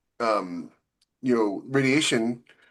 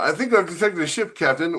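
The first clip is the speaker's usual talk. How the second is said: higher, lightly voiced ship's officer; crewman reporting; 5 semitones higher than usual. Sensor officer reporting a ship to the captain, lightly voiced higher crewman. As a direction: play a sensor officer calling it in.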